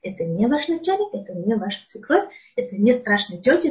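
usual speaking voice

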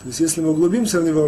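ordinary talk

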